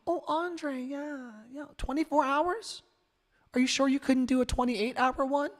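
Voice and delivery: high, soft, feigned interest; mock polite response. Imitating unimpressed people answering his radio station plea, mock polite. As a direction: put on a hushed, high-pitched faint praise. Voices indifferent viewers' empty replies.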